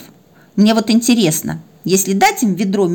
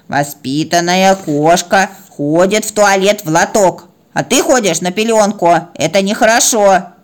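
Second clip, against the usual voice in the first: bossy teacher cat. Acted. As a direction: spell it out importantly for a small pupil. Cat lectures the puppy. (важно и назидательно, нараспев)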